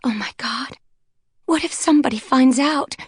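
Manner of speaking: put-on a scared girl about to cry; panicked sob. A narrator's reading. Blonde fearing they'll be caught, panicking. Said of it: "A tiny sob escaped".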